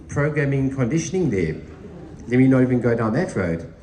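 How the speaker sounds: ordinary talk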